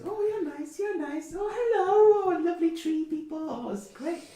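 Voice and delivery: put-on very high, cooing old woman; squealing with delight at the tree people; delighted old lady. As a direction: coo over the guests like a thrilled granny.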